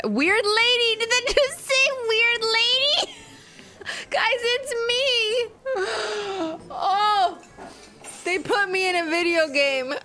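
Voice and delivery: high, excited squeal of recognition; real high-pitched excitement; excited disbelief. Real, not acted. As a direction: squeal it, thrilled to find yourself there. Spots themself in the game.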